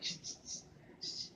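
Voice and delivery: breathy shushing snicker, no voice; the classmate snickering at the narrator; imitated stifled snicker. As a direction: mimic a kid giggling behind his hand. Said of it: "this boy had the nerve to laugh at me".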